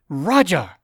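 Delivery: loud sharp snap of his name; snapped. Acted. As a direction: bite his name off in anger. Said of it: "Betty snapped".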